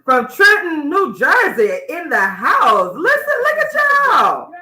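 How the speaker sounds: loud, stretched-out hype shout; hype introduction. Real, not acted. Radio host introducing a guest from Trenton, hyped-up shout. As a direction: holler it out, soaring high on the last words.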